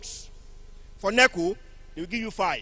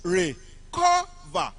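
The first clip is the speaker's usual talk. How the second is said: "Re, cover" split, CO raised and punched; second-syllable stress; 7 semitones higher, much slower than usual. Shown as a demonstration. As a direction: break after "Re", then hit "cover" on CO. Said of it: "on the second syllable".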